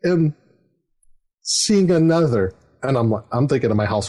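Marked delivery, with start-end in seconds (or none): 0.0-2.5 daughter confessing. Retold or imitated. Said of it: "she's like".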